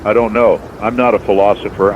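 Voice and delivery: ordinary delivery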